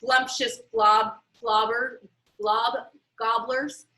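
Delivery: plain reading voice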